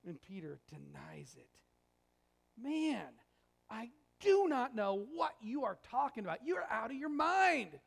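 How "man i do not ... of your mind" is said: emphatic denial. Acted. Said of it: "the most emphatic denial".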